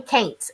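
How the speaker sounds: can't said as "caint", rhymes with paint; regional pronunciation. Retold or imitated. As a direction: say can't with a long AY vowel, "caint". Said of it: "they would say, "Why are you saying".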